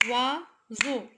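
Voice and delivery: "Oiseau" split as OI, pause, SEAU; syllable by syllable. Shown as a demonstration. Teacher counting syllables for pupils, "Oiseau" chopped in two. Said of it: "deux syllabes".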